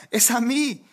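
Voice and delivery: high comic yell from afar; imitated shout to claim the call; mock shout. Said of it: "Judas en el infierno gritaba".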